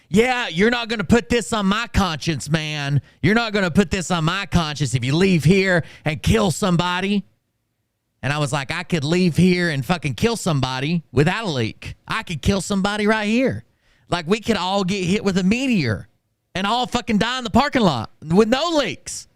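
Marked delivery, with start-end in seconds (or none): 0.1-7.2 mocking kid. 8.7-19.3 heated retort. Retold or imitated. Retelling own heated reply.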